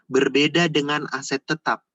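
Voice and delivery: ordinary talk, nothing marked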